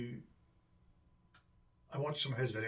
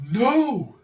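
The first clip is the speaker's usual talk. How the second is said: much higher, much louder mock cry of protest; outraged no; 18 dB louder than usual. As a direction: shout no as if betrayed. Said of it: "and I was like".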